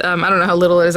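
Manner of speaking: ordinary delivery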